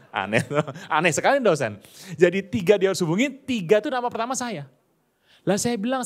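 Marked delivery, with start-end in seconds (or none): none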